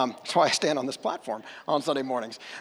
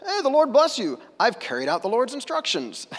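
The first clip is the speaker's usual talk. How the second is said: bright, much higher eager greeting; louder, high, falsely cheerful greeting; cheery Saul; 8 semitones higher, 5 dB louder than usual. Retold or imitated. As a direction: play it like an innocent eager boast. Saul's cheery greeting quoted.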